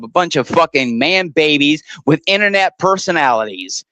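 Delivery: usual speaking voice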